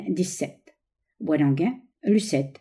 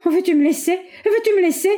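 high, loud repeated demand; struggling protest; 11 semitones higher, 4 dB louder than usual. A narrator's reading. Lucette struggles against her lover's hold, high and insistent. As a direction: repeat it higher, fighting to get free.

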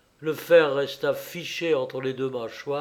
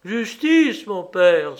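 high, loud outcry; crying out; 5 semitones higher, 8 dB louder, slower than usual. A narrator's reading. Wronged prince appealing to his father, loud cry. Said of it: "s'écria ce pauvre prince".

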